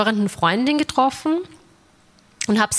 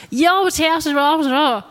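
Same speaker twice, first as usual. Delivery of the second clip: high, drawn-out garbled Viennese dialect; mock a ranting Viennese in incomprehensible dialect; imitated Viennese street grumbler, high-pitched; 7 semitones higher, much slower than usual. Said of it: "Sie sprechen Dialekt".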